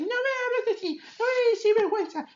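mock Laura arguing back with Logan; imitating Laura. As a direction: shoot back quick and high like a defiant kid. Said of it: "She's like".

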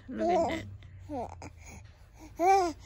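loud, high delighted exclaim; excited. Real, not acted. Mother amazed at baby.

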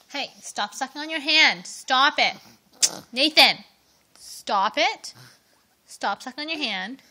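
loud, firm commands; firm scolding. Real, not acted. Parent telling baby to stop sucking hand, scolding.

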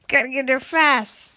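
normal talking voice

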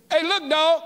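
high, brash street greeting to the king; cocky prophet hailing the king; bold Elijah. Acted. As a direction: call out the king like a buddy. Preacher acts Elijah confronting king.

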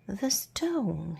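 ordinary delivery, nothing marked

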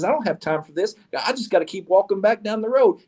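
high-pitched, exasperated brush-off; flustered protest. A narrator's reading. Man brushes off the woman to keep walking, flustered. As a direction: wave it away in a high, hurried fuss.

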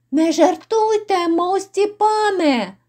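high, stretched plea to a master; anxious pleading. Acted. Innkeeper begging the lord not to joke, high anxious plea. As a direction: plead upward, drawing out the address.

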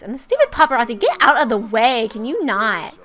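loud exasperated telling-off; loud, irritated outburst; annoyed scolding. Real, not acted. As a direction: shoo them off loudly and crossly. Scolds game paparazzi in the way.

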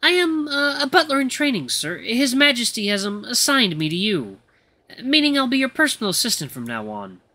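timid, deferential servant with ums and pauses; nervous. Acted. New butler introduces himself to his master, nervously formal. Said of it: "he seems really nervous to be around me".

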